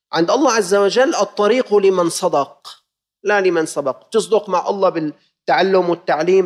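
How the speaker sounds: normal talking voice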